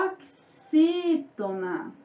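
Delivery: "oxítona" slowly pulled apart into syllables; stretched, deliberate "o-xí-tona". Shown as a demonstration. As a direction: dictate "oxítona" at half speed. Teacher spells out the term slowly.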